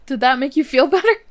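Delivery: high, loud, quick question breaking into laughter; laughing tease. Real, not acted. Gamer to sim shouting forbidden words, laughing tease. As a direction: tease through a burst of laughter.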